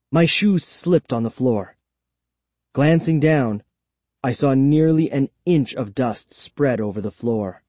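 ordinary delivery, nothing marked

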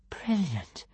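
awed, hushed whisper; soft, breathy-quiet whisper of admiration. A narrator's reading. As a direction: whisper it in amazement. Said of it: "whispered Kamikaze".

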